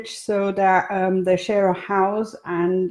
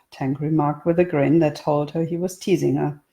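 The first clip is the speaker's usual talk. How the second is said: ordinary delivery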